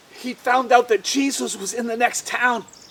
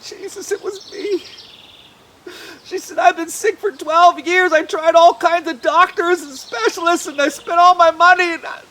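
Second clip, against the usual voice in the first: high, earnest and loud; pleading woman; 5 semitones higher, 9 dB louder than usual. Acted. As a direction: confess it tearfully. Sick woman confesses.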